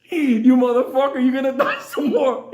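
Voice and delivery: loud, high mock-rage shout; retold furious threat. Driver still fighting the trapped baboon, mock-furious shout. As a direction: shout it high, half laughing.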